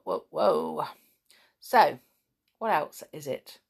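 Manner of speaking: ordinary delivery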